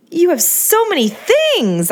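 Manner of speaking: high, slow mock shriek. A narrator's reading. To Angeline about her cars, a high mock shriek. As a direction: squeal it high and drawn out. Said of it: "I shrieked".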